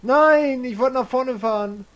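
loud, high cry of protest; real dismayed yell; frustrated shout. Real, not acted. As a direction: yell it as the plan falls apart. Tank gets shot, frustrated.

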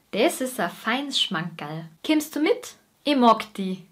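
broad Bavarian dialect, relaxed and homely; a born Bavarian imitating her home dialect; Bavarian accent. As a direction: talk like someone from rural Bavaria. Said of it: "deshalb kann ich das auch ganz gut imitieren".

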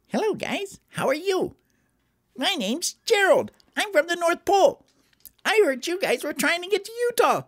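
tiny, very high cartoon voice; high squeaky elf greeting; Gerald. Elf introduces himself.

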